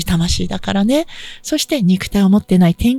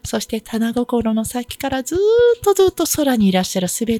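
guided meditation; 2 semitones higher, 5 dB quieter than usual. Acted. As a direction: guide listeners gently into prayer. Leads a short healing for listeners.

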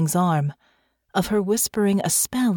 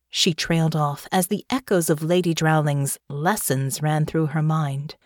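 ordinary delivery

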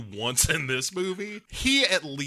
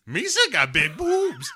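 high, loopy cartoon-alien voice; Jar Jar Binks; 11 semitones higher, 4 dB louder than usual. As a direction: squeal it in goofy pidgin. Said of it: "a weird blend of Jar Jar Binks".

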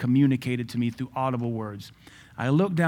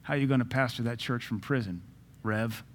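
normal talking voice

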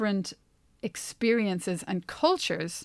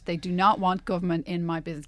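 ordinary talk

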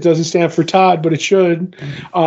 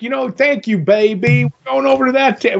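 put-on a weak, higher-pitched try at an Australian accent; lightly put-on Australian accent, pitched up; attempted Australian accent; 7 semitones higher than usual. Quoting the artist thanking him, in a half-hearted Australian accent. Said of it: "I can't do an Australian accent".

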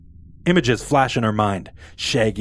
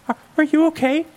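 put-on much higher, hesitant girl; a worried girl asks the tired stranger, hesitant; timid Daphne. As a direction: stammer the question nervously. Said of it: "asks Daphne".

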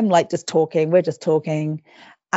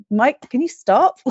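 higher, drawn-out plea to stop; slow, exasperated protest in a raised pitch; retold exasperation; 6 semitones higher, slower than usual. Wife retelling her protest to teasing husband, exasperated.

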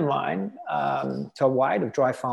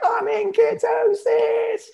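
very high, loud, drawn-out squeal of triumph; mock elation; 5 dB louder, slower than usual. Dieter seeing the meter reach ketosis, mock-elated squeal. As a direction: celebrate it in a hammed-up, slow falsetto cry. Acts out hitting the ketosis threshold.

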